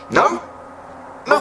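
very high, squeaky refusal. Pretend. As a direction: squeal it like a stubborn ridge. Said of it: "And your ridge is like".

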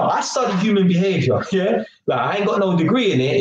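normal talking voice

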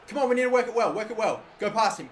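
normal talking voice

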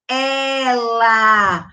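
"Ela" with a wide open E. Shown as a demonstration. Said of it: "O som do E é aberto".

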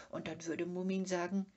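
ordinary delivery

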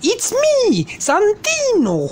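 voz de personaje muy aguda, con acento marcado; preséntate en agudo, alargando mucho el nombre; Santino. Acted. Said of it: "acento tan marcado". (presentación chillona con acento exagerado)

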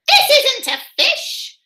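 high squawk with a laugh; a gull cackling at his mistake; laughing seagull. A narrator's reading. Seagull Solomon finding the bear is no fish, laughing high.

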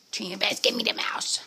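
coaxing whisper to the dog. Real, not acted. Coaxing dog to drop toy.

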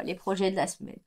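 a groan of mock dread. Real, not acted. Knitter dreading her week of projects, mock groan. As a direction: sigh it like a chore you dread. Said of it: "Oh là là".

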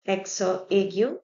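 accent aigu as "ekso ay-gyu"; French-style "ekso ay-gyu" for accent aigu; French term. Shown as a demonstration. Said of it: "in English, acute accent".

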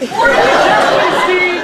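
loud, high scolding outburst; exasperated shout. Acted. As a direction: snap at him in frustration. Doctors arguing over amputation.

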